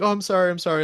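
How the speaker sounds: high, quick repeated sorry; typical Canadian apology. Retold or imitated. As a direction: fluster through two apologies. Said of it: "the typical".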